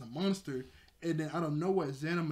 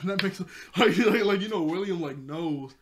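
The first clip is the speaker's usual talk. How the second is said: laughing; 4 semitones higher, 10 dB louder than usual. Real, not acted. Laughing about William's advice.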